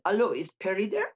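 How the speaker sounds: lightly put-on French accent. French boutique caller phoning the host, French accent. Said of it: "I'm not gonna do the accent anymore".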